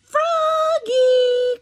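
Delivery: high, loud call across the house; mother calling. Acted. Mother calling Froggy to come, loud high call. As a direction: call him like a mom down the hall. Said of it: "called his mother".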